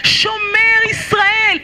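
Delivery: slow, drawn-out cry of the Shema; fervent prayer. Acted. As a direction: stretch every word out like a plea. Leads recitation of Shema passage.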